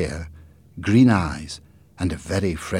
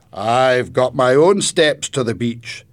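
louder, higher, slow and proud; proud grandfather; 6 semitones higher, 9 dB louder, slower than usual. A narrator's reading. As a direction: show it off with pride. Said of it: "he explained proudly".

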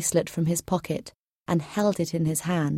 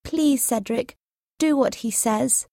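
higher-pitched, mildly distressed pleading; unhappy plea; 6 semitones higher than usual. A narrator's reading. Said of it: "she said unhappily".

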